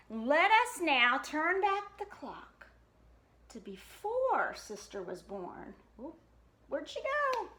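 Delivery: ordinary delivery, nothing marked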